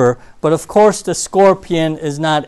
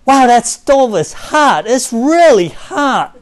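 higher, slow, wide-eyed about the hot stove; mock alarm; 7 semitones higher, 5 dB louder, slower than usual. Preacher acting out touching a hot stove, hammed-up alarm. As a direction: overplay the shock at the heat. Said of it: "if I come to you and say".

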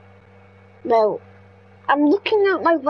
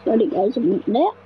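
ordinary talk, nothing marked

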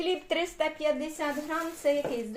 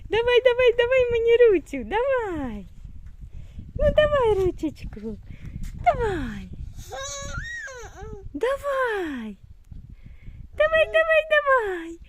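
eager coaxing; 10 semitones higher than usual. Real, not acted. Urging toddler to stand.

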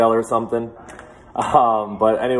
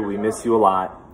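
ordinary talk, nothing marked

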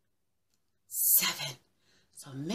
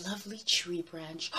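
usual speaking voice

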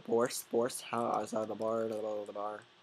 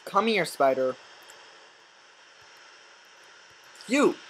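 louder, higher yell at the spider; real loud shout; angry shout; 5 semitones higher, 7 dB louder than usual. Real, not acted. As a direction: call the spider out furiously. Yelling at spider.

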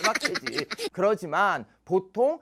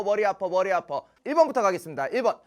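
ordinary talk

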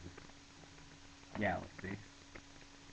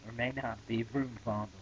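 shouting; 4 semitones higher, 10 dB louder than usual. Acted. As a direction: bellow the demand at the computer.

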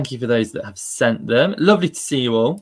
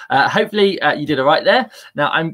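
ordinary talk, nothing marked

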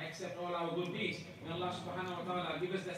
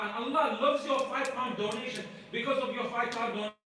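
rising to full preaching force, louder and higher; fervent preaching; 6 semitones higher, 7 dB louder than usual. Acted. Preacher builds up to fervor.

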